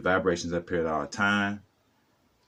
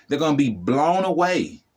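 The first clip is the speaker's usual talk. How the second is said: normal talking voice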